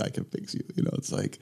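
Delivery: unvoiced whisper, mocking himself; whispered aside. Real, not acted. Doctor whispering a joke at his own expense. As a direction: breathe it out as a wry aside.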